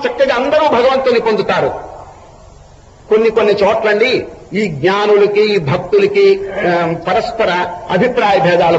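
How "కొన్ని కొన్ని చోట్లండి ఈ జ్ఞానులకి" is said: ordinary delivery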